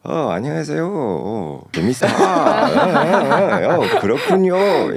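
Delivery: exaggerated bouncy up-and-down Korean, laughing through it; mock Korean greeting sung up and down like English; Korean with English intonation. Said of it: "I'm like".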